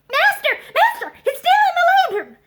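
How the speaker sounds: high, loud alarmed shout; shouting old servant. A narrator's reading. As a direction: yell for the master in alarm. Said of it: "shouted the ancient".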